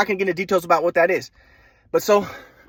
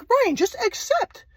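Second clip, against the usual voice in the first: much higher, slow, mock-serene old man; mock grandpa voice; slower than usual. Grandson mocking his grandpa's advice, high and slow. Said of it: "he's like".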